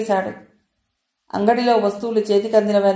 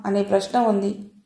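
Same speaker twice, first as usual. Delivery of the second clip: ordinary delivery, nothing marked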